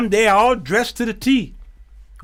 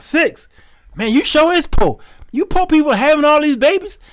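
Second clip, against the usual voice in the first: higher, incredulous teasing; mock disbelief; 8 semitones higher, 4 dB louder than usual. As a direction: pity the poor man with too many kids. Mocking inner reaction to six kids.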